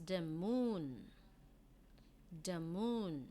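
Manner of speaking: the moon said slowly and clearly for pupils to copy; teacher modelling "the moon"; model reading. Shown as a demonstration. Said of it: "you say this".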